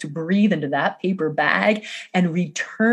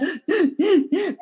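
sharp gasp; 11 semitones higher than usual. Real, not acted. Gasp while crying.

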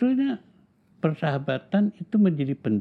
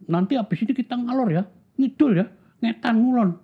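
friends calling out; 11 semitones higher, 4 dB louder than usual. Retold or imitated.

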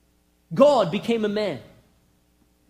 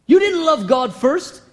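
ordinary talk

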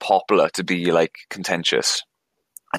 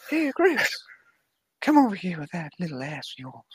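put-on high, creepy old man voice; quavering old creep, high and thin; Herbert from Family Guy; 9 semitones higher, 4 dB quieter than usual. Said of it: "you do it disturbingly well".

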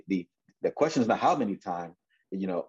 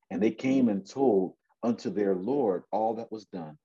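usual speaking voice

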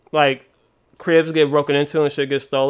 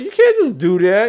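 mock protest; 7 semitones higher, 4 dB louder than usual. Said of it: "He's like".

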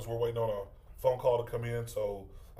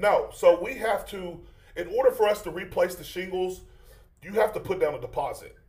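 insistent roofer on the phone; prank caller voice; 6 semitones higher, 6 dB louder than usual. Acted. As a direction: push the deposit firmly.

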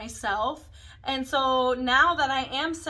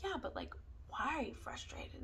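soft; 13 dB quieter than usual. Retold or imitated. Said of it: "And she's like".

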